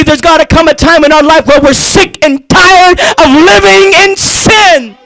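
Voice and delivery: ordinary talk, nothing marked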